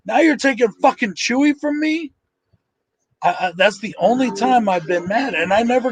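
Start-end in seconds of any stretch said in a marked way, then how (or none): 0.0-2.1 mock outrage, pitch climbing much higher to the end; indignant complaint rising higher and higher; retold angry protest. Fan retelling his outrage at the film, pitch climbing higher. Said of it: "I got mad".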